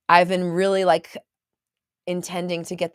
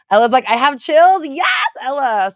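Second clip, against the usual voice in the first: excited; 9 semitones higher, 10 dB louder than usual. Real, not acted. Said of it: "I'm so excited".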